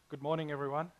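usual speaking voice

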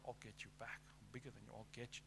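quiet, low imitation of someone vowing payback; muttered retaliation. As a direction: mutter it under your breath like a grudge. Acting out a vengeful retort.

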